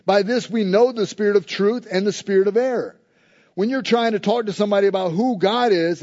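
usual speaking voice

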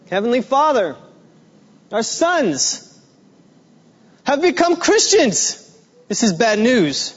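a worried father half-jokingly begging heaven; mock anxious prayer. Men praying to God in joke.

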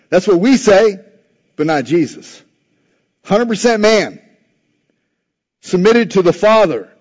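high, loud, impassioned sermon delivery; full-force preaching. Acted. Preacher rallying the congregation, loud impassioned preaching. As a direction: preach it out to the back row.

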